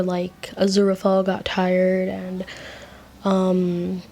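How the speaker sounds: ordinary delivery, nothing marked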